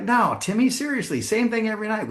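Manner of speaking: mock scolding of a pestering son; exasperated mom. Voicing a fed-up mom.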